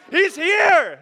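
loud high proclamation of here; proclaiming. Shown as a demonstration. Preacher calling to the congregation, loud and high. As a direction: ring out the word here. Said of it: "Somebody say".

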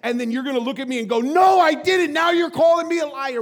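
loud, high, mounting indignation; impassioned preaching as God. Acted. God rebuking those who deny sin, indignant preaching. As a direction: build a driving list to a shouted accusation.

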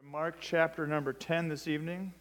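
ordinary delivery

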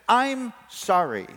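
high, slow, overdone apology; forced apology. Acted. Boy ordered to apologize in a sentence, grudging. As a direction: stretch out an apology under orders.

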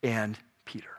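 "and Peter" whispered, lingering on each word. Shown as a demonstration. Preacher to congregation, whispering "and Peter" with awe. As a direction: whisper "and Peter" as a precious secret. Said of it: "the only one that adds".

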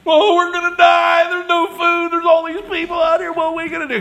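hammed-up despair, yelled high; panicked shout. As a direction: cry out in mock panic like doomed Israelites. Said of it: "we're on the other side going".

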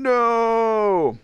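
long, high-pitched wail of no; mock freak-out. Boyfriend hearing she's pregnant, mock freak-out. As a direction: stretch out a high panicked cry. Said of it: "So now I'm freaking out".

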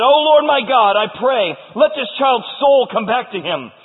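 high, loud plea to God; impassioned prophet begging heaven; pleading prayer. Acted. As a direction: cry out a fervent petition. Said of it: "by a pleading of Elijah".

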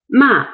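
ma started high and loud, falling sharply; dropping fourth tone. Shown as a demonstration. Teacher modelling the fourth tone, dropping. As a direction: drop ma steeply from a high start. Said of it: "reducing tone or drop".

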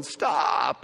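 usual speaking voice

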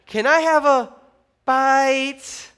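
high, loud, cutesy begging; drawn-out cute plea; cute pleading wife. Acted. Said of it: "And she winks cute".

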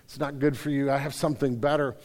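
hushed, loving reassurance from above; loving voice of God. Retold or imitated. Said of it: "the voice of God is just lovingly saying".

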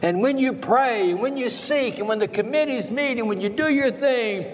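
high, fervent pulpit exhortation; rally the church like a revival preacher; impassioned preaching. Acted. Preaches at full force.

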